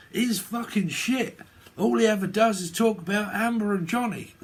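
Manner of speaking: mocking hater. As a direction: put on a high voice imitating a hater. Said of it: "people up that hating in my comments".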